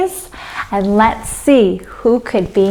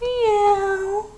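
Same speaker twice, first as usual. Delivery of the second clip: high, soft kitten meow; tiny mewing cat; 10 semitones higher, 8 dB quieter than usual. Shown as a demonstration. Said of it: "and cat".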